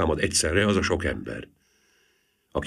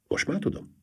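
short, soft-edged curt reply; 10 semitones higher, 4 dB quieter than usual. A narrator's reading. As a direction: answer briefly and shut it down. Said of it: "felelte kurtán".

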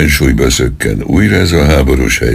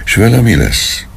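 put-on higher child's question, rising in worry; lightly voiced child asking; 9 semitones higher than usual. A narrator's reading. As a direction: ask it like a small worried boy. Child figure asks.

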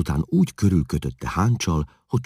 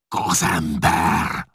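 a slow, drawn-out bellow, louder; furious chieftain bellowing the word out; roaring; 4 semitones higher, 5 dB louder, much slower than usual. A narrator's reading. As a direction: roar the insult at him. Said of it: "ordította olyan hangon".

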